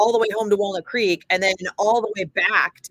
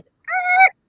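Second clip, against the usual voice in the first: a high, loud mock groan of something crashing; a quick cartoonish crash sound; imitated crash noise; 7 dB louder than usual. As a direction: squeal it out like metal hitting metal.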